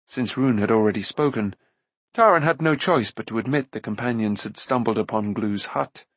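plain reading voice

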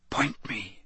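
hushed, voiceless whisper. A narrator's reading. Harry gives his wand a command alone, whispered. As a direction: whisper it very softly. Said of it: "he whispered to his wand".

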